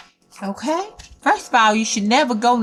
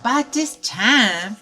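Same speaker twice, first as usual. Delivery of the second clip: usual speaking voice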